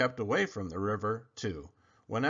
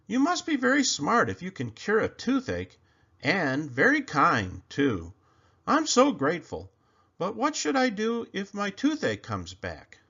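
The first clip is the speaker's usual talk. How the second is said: grateful crocodile; 7 semitones higher than usual. Acted.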